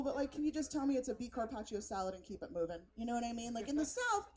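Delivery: ordinary talk, nothing marked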